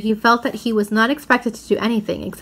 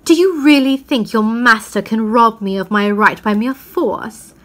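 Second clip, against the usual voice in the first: defiant witch; 5 dB louder than usual. Acted. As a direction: warn them of ruin, coldly. Witch defies Aslan's followers.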